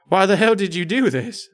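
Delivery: high-pitched, strained mock scream; screaming in horror. A narrator's reading. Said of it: "Bert screamed".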